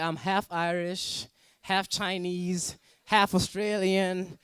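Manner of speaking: drawn out, slow and deliberate; slow mock claim. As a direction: stretch each half proudly. Claiming mixed heritage jokingly.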